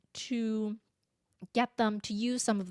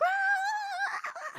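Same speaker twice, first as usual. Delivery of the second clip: high, drawn-out mock scream; imitated child's scream. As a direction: imitate a screaming toddler without full volume. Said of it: "she's still screaming".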